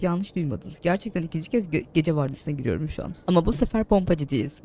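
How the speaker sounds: ordinary delivery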